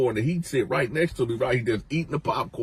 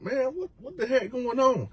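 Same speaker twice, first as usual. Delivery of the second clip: startled; 10 semitones higher, 4 dB quieter than usual. Retold or imitated. Inmates startled.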